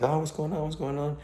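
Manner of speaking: high-pitched mock cries of alarm; imitating panicked crowd. Dreamer voices a crying crowd in the secure room, mock panic. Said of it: "people crying and stuff like".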